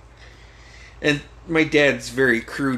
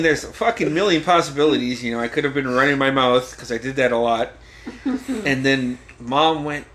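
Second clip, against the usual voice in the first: ordinary delivery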